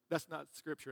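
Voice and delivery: usual speaking voice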